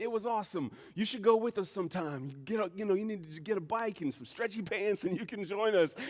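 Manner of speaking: high-pitched enthusiastic pitch to a friend; eager invitation. Retold or imitated. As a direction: re-enact an upbeat recruiter. Acts out own eager invitation.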